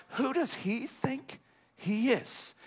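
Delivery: doubting mourners muttering at Jesus; scoffing crowd. Retold or imitated. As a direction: sneer it under your breath, slowly. Mocking crowd quote.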